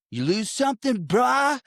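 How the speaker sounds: high-pitched, hammed-up surfer bro; try-hard surfer. Podcaster mocking a film villain's line to the co-host, try-hard surfer. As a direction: put on an over-eager surfer voice. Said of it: "trying too hard to be a surfer still".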